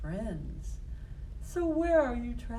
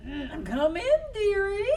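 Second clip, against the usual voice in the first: wolf's put-on old lady voice, rising high on dearie; mock granny voice; 11 semitones higher, 3 dB louder than usual. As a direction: sweetly lure her in. Wolf in bed poses as grandma.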